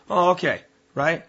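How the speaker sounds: hammed-up Italian, rushing the order; mock Italian accent. ISIS handler pushes a recruit to speak on camera, in a mock Italian accent. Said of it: "sound Italian".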